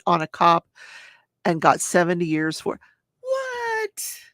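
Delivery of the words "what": very high, stretched out what; a cry of outrage at the sentence; shocked disbelief. Real, not acted.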